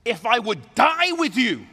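loud, high vow with dips; fervent, emphatic pledge; passionate conviction. Acted. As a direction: swear it with all your heart. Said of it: "with conviction, with passion".

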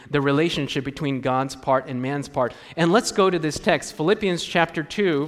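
ordinary delivery

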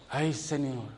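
soft, hushed sigh to the Lord; a sigh kept inside your head; inner sigh. Retold or imitated. Young believer sighing to the Lord, hushed.

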